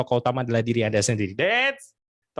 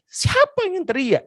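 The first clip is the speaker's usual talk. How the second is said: high, slow startled question; high drawn-out who-shouted question; startled man; slower than usual. Acted. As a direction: jolt awake and ask who yelled. Woken man asks who shouted.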